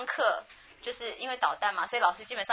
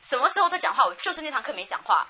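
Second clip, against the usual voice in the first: angry protest; 4 semitones higher, 6 dB louder than usual. Retold or imitated. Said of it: "我就很生气， 我就跟老师讲".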